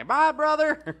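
high, loud sing-song farewell; chirpy brotherly goodbye; mock sweet goodbye. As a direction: wave him off all cheerful. Brother waves goodbye, mocked.

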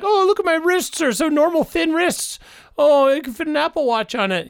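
high, smug mocking voice; mock him in a high prissy voice; gloating impression. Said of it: "He's kinda like".

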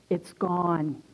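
low, soft, stunned murmur; acted hushed disbelief; hushed realization. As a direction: say it quietly, barely believing it. Stunned first realization of deliverance, retold.

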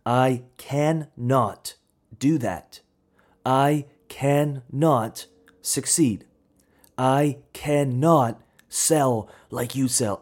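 very slow, every word pressed down; slow emphatic i cannot. Acted. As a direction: drag out each "cannot" like a heavy weight. Mocking the "I can't" mindset.

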